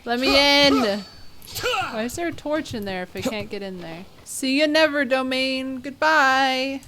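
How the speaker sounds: usual speaking voice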